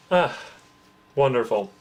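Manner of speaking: normal talking voice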